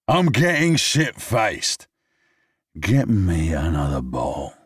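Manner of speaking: high, slow, slurred order; drunken demand. Acted. Drunk man demands another drink, slurred and high. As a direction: slur it like a sloppy drunk.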